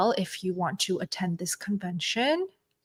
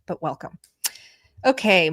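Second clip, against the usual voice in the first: normal talking voice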